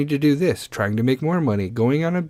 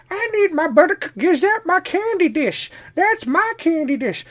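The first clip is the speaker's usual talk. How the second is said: put-on grumpy old person. Grandparent scolding grandkid over butter cookies, high crotchety voice. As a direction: squawk like a cranky grandparent guarding the cookies. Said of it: "Old people are just like".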